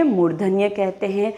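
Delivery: normal talking voice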